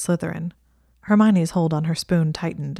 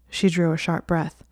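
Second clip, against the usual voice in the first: usual speaking voice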